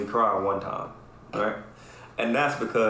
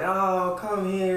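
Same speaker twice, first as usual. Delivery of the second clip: mock cooing aunties; 9 semitones higher, much slower than usual. Aunties cooing over crying boy.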